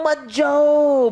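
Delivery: mock wail, high and hugely drawn out; pleading molecules. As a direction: stretch it into a hammed-up plea. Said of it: "तो नीचे वाले molecules कह रहे". (नाटकीय, लंबी खिंची हुई गुहार)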